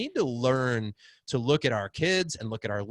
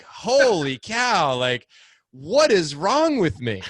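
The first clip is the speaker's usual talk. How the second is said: higher, louder, slow appalled cry; shocked at himself; 7 semitones higher, 9 dB louder, slower than usual. Retold or imitated. Dad realizing he doubted his son, shocked. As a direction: throw up your hands at your own mistake. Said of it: "I again was like".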